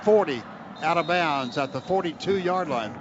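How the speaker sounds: normal talking voice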